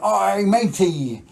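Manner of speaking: loud, high, drawn-out pirate call; slow hammed-up pirate holler; pirate greeting. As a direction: hail them like a jokey pirate. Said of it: "I met a pirate once".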